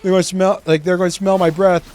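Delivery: high, loud worried inner voice; panicky inner voice. Retold or imitated. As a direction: panic about bad breath. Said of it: "overthinking it part of my brain that's like".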